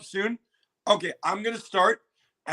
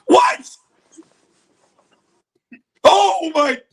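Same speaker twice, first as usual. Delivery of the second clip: high, loud gasping disbelief; floored, with a gasp; shocked exclamations; 12 semitones higher, 9 dB louder, much slower than usual. Real, not acted. Reacting live on stream, high loud shocked gasps.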